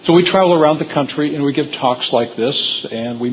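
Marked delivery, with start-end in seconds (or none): 0.0-0.8 raised, higher voice for the audience; speaking up. Real, not acted. Speaker answers a request to speak louder, speaking up. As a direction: project so the room can hear. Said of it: "I'll try to speak louder".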